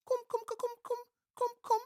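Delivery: very high, chirpy little monkey voices; high monkey chorus beckoning; squeaky monkey call. A narrator's reading. Monkeys calling Anansi in.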